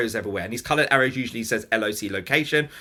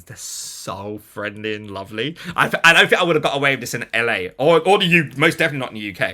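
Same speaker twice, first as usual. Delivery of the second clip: ordinary delivery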